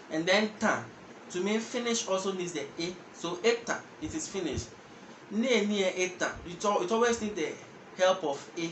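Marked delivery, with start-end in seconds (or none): none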